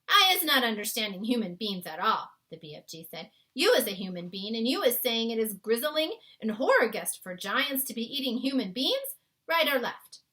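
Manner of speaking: put-on a kindly giant explaining his world to a girl; BFG voice. A narrator's reading. BFG's lines in story.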